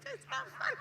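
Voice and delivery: giggling while insisting it's no joke; laughing. Real, not acted. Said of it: "I'm sorry I'm laughing".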